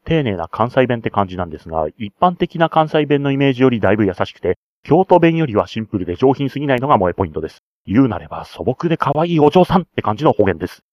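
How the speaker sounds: fast, gushing fan rant; heated up. Real, not acted. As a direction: get carried away praising the cute dialect. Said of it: "ついヒートアップしすぎてしまいました".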